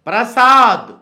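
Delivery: PRASAAD with a long, stressed 'saa'; ప్రసాద్ sounded out clearly, ending on a fall; pronunciation demo. Shown as a demonstration. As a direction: draw out the second syllable of ప్రసాద్. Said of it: "ఉచ్చారణ చూడండి ఎలా ఉంటుందో".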